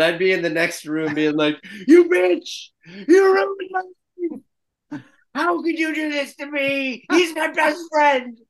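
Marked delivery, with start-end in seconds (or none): 1.9-8.4 much higher and louder, shrieking accusations; mock screaming fight. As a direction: scream like a betrayed lover on air. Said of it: "scream at someone right now".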